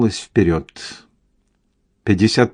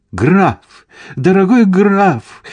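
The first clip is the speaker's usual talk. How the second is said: high, drawn-out, louder hail; gushing cry; 10 semitones higher, 4 dB louder, slower than usual. A narrator's reading. Lady Cameron hailing the count, gushing cry. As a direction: call out eagerly to an esteemed acquaintance. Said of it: "кричала леди Кэмерон".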